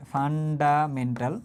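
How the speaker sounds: 'Fundamental' very slow, syllable by syllable; slow dictation. Shown as a demonstration. Teacher repeats what F stands for, slow dictation. Repeats term slowly for note-taking.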